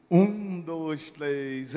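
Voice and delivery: loud count-in for the congregation. Real, not acted. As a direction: call out the count. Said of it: "vai cantar comigo assim no três". (contagem em voz alta)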